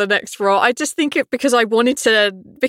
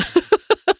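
short giggle high up; a bright burst of laughter, rising; 11 semitones higher than usual. Real, not acted. Laughing at own cuteness.

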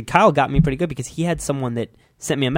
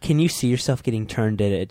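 normal talking voice

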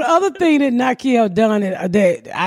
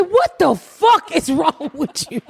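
slow, higher-pitched exasperated disbelief; aghast mother; 6 semitones higher, slower than usual. Retold or imitated. Mother answers her son's question about Obama, aghast. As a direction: draw it out, appalled and incredulous.

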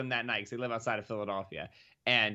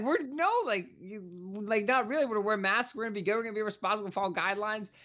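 higher-pitched, halting reassurance; flustered reply; 8 semitones higher than usual. Retold or imitated. Interviewed fan answering if he's worried, flustered. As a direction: imitate being caught off guard, stammering into promises.

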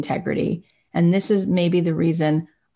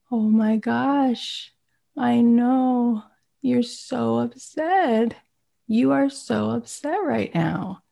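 slow, higher, tender naming of the feeling; attuned, soothing mom; 6 semitones higher, slower than usual. Retold or imitated. Mother naming the upset baby's feeling, slow and tender. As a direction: stay with the baby, slowly, gently. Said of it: "the mother can say something like".

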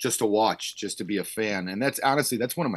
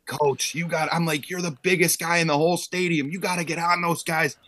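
higher, pushy urging of the coach; mock pep talk; 7 semitones higher than usual. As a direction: egg the big coach on, hammed-up. Urging head coach to yell.

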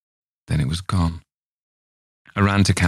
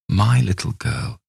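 plain reading voice

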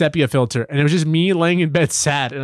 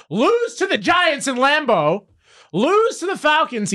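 rising worked-up fan outburst, voice pitched much higher and slowed; getting mad; 10 semitones higher, slower than usual. Real, not acted. Fan reliving losses to friends, getting worked up. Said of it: "now I'm getting mad".

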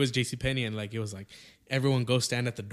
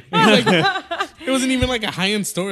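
much higher, louder words through real laughter; laughing; 12 semitones higher, 10 dB louder than usual. Real, not acted. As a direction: laugh while you say it. Laughs telling about JCPenney.

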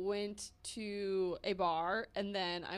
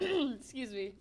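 cracked, strained throat-clearing voice; voice cracking; 3 semitones higher, slower than usual. Real, not acted. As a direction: voice catching and breaking. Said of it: "My voice just cracked".

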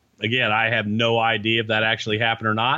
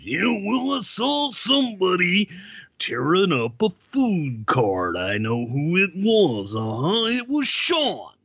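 high, slow, gravelly-free Macho Man growl pitched way up; Randy Savage impression; 7 semitones higher, slower than usual. Guest retelling the food story imitates Randy Savage, high and slow. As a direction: drawl it out like the Macho Man telling tales. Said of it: "And Randy Savage".

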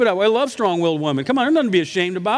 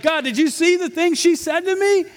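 whiny complaint; 11 semitones higher than usual. Retold or imitated. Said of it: "And I'm like".

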